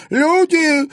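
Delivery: put-on high, loud old crone scream; frantic yell. A narrator's reading. Old woman screams for help, high and loud. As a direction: shriek for help. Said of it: "истошно завопила старуха".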